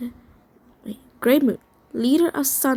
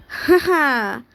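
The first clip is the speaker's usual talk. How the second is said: louder, mock "ha ha"; acted laugh; 2 semitones higher, 5 dB louder than usual. A narrator's reading. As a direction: laugh it out in character. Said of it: "Talon laughed".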